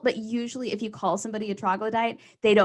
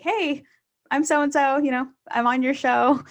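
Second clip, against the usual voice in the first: higher, chirpy self-introduction; comic introducing himself to a show runner, higher and chirpy; mock eager comic; 6 semitones higher, 4 dB louder than usual. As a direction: lightly play a comic greeting the booker. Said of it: "go up to them and be like".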